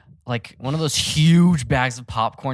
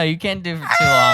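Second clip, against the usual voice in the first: normal talking voice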